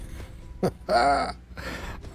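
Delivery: amused laugh at a funny line; long, genuine chuckle; real laugh. Real, not acted. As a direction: crack up, mostly on breath. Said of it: "Oh, that made me laugh".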